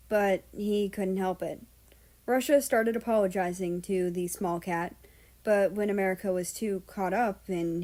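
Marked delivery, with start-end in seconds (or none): none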